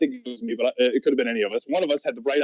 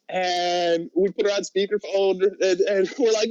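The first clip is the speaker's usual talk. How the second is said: usual speaking voice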